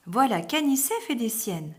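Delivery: ordinary delivery, nothing marked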